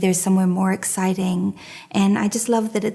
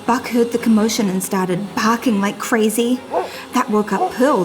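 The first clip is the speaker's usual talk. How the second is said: usual speaking voice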